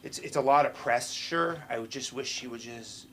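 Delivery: ordinary talk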